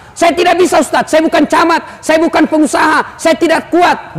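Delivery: whiny excuses. Retold or imitated. Congregant making excuses.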